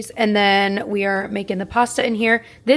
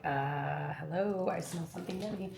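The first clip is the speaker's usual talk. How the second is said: voicing the dog; 3 semitones lower, 13 dB quieter than usual. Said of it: "He's like".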